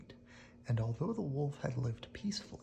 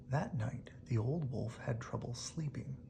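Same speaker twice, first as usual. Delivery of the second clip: plain reading voice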